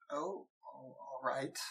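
very soft, hesitant acceptance; hushed and guarded; wary. Acted. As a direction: agree warily under your breath. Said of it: "looking wary, just kinda slowly nods".

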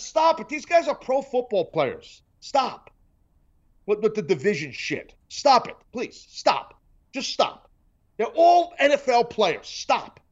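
high, pleading stop it; fed up with division talk, pleading to stop; exasperated ranting. Real, not acted. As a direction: beg them to stop, worked up. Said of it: "I'm flipping out over here".

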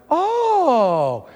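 high, drawn-out swoop down; a mock-awed "oh", sliding down from high; impressed oh. Impressed lounge staff, acted.

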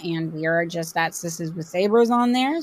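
ordinary delivery

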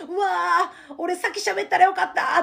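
exaggerated regretful whine; mock regret. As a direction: wail it like a sore loser. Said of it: "っていうね". (大げさに悔しがって)

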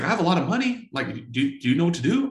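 high, eager pitch from the coworker; eager rich coworker. Retold or imitated. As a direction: sound keen to put money in.

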